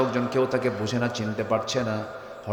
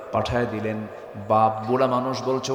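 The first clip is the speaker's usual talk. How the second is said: normal talking voice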